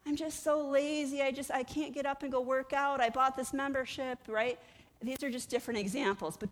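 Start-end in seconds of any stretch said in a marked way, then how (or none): 0.0-4.2 higher, mopey mock self-pity; self-pitying whine. Gym quitter moaning about laziness, higher mock self-pity. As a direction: sigh out the excuses. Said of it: "we're like".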